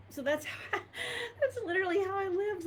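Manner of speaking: real crying breaking into the words; overcome, high-pitched tearful admission; choked up. Real, not acted. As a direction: voice shooting high with tears. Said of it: "I don't know why I'm so emotional".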